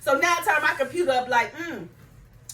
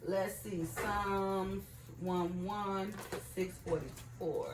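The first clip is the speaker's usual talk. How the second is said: very soft, low, slowed murmur while searching; talking to self; 8 semitones lower, 14 dB quieter, much slower than usual. Real, not acted. Preacher hunting for her Psalm, murmuring to herself. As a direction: look it up under your breath, drawing it out.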